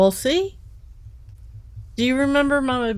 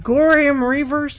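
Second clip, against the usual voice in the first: growled villain name; 7 semitones higher, 6 dB louder than usual. Retold or imitated. Sci-fi villain name shouted.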